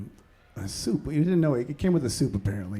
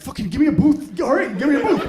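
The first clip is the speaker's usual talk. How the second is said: higher, louder frantic demand for a booth; mock urgent shout; 7 semitones higher, 5 dB louder than usual. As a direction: plead for the booth like it's an emergency. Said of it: "And you're like".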